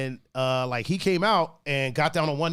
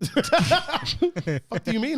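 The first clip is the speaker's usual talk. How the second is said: laughing disbelief; 8 semitones higher, much slower than usual. Retold or imitated. Retold confused reaction.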